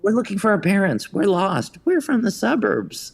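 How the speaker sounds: a high mock-innocent lost kid voice; lost-kid act. Kid sneaking into theatre pleads with usher, high mock innocence. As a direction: play the innocent lost child, lightly. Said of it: "we just figured".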